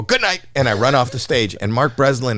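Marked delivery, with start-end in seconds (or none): 0.0-0.4 high, bright mock sign-off; quick goodbye. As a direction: toss it off high as you flee. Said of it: "and I go".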